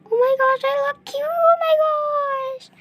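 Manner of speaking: very high, gushing squeal; high-pitched fangirl gush; delighted squeal. Real, not acted. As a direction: squeal over how cute you look. Delighted at own cute baby look.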